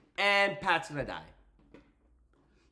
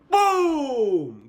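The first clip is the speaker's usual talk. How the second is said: loud, higher, announcer-style boom; hyped boom; 8 semitones higher, 10 dB louder than usual. Pretend. Hyping Goldberg's entrance with a loud boom. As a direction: punch it like an entrance hit.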